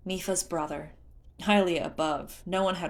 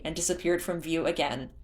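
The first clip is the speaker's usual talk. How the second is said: plain reading voice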